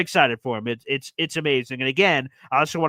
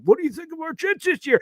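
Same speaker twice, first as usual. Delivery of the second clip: high, thin imitation of an old man; imitated old man asking; 14 semitones higher than usual. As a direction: ask about the team like the old regular. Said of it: "every time he's like".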